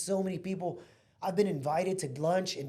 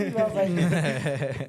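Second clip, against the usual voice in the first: laughing; 8 dB louder, much slower than usual. Real, not acted. Laughing mid-story at himself.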